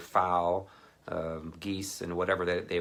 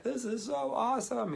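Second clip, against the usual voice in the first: thrilled. Retold or imitated. Thrilled in parachute.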